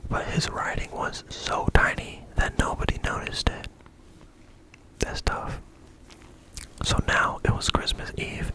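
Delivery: ordinary delivery, nothing marked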